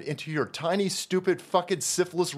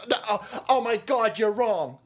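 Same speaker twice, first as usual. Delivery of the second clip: mock flustered protest, a little higher; flustered protester; 4 semitones higher than usual. Protester flustered at being told off, mock protest. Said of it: "The guy".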